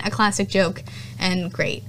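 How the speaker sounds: ordinary talk, nothing marked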